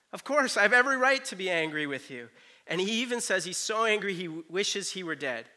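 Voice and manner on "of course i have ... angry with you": raised, louder indignant retort; acted righteous indignation; self-justified anger. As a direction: insist on your right, mock indignant. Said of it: "Jonah believes that he's fully justified".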